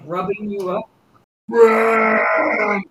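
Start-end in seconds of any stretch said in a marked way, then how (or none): 1.5-2.8 long, loud, high creature bellow; drawn-out beastly cry; affectionate monster roar. Acted. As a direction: roar it out while hugging. Monster cuddles player.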